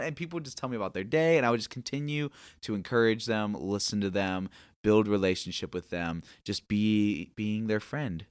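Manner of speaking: ordinary talk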